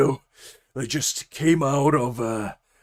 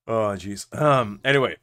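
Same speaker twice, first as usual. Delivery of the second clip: ordinary talk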